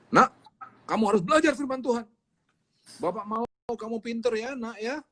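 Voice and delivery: put-on a father nudging his kid to be smart; fatherly urging. Father urging his child to study, lightly coaxing.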